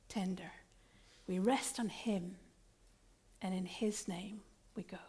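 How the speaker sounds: whispered sending-out; hushed benediction. Acted. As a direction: let the words fade to a whisper. Quiet benediction close.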